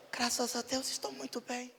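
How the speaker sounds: a timid, proper murmur; prim soft reply. Retold or imitated. Polite church lady answering the pastor, softly.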